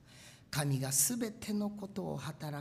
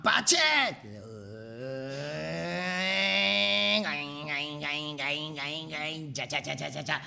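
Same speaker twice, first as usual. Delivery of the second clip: imitated machine noises; 5 semitones lower, faster than usual. Preacher acting out the machine, clanking sound effects. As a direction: mouth sound effects of a rumbling cracker machine.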